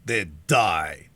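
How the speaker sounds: low, loud, furious shout. A narrator's reading. Magua at the unresisting Alice, low furious shout. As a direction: hurl it out in rage. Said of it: "shouted Magua".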